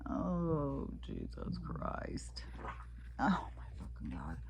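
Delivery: hushed, breathy oh my Lord; whisper. Real, not acted. Vlogger watching a disgusting clip, whispering. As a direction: mutter it under your breath in disbelief.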